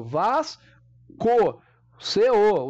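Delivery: "Vasco" split as "Vas... CO" with a hard K; pronounced C. Shown as a demonstration. Teacher proving to pupils that C is sounded, split pronunciation. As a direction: break "Vasco" before a firmly sounded "CO". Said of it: "Aqui o C é pronunciado".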